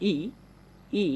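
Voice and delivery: ই held long and drawn out; long ই sound. Shown as a demonstration. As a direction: stretch the ই sound. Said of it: "সময়টা বেশি নিতে হবে". (লম্বা করে টেনে ই উচ্চারণ)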